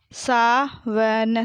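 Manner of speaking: Sawan drawn out as sa, va, na; very slow Sawan, each letter held apart; spelled out. Shown as a demonstration. Teacher sounding out Sawan for pupils, syllable by syllable. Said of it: "मैंने इस तरीके से पढ़ा".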